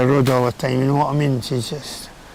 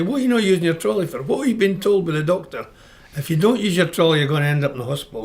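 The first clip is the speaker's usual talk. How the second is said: retold scolding; 3 semitones higher than usual. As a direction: scold her the way the doctor would. Scolding wife about her trolley.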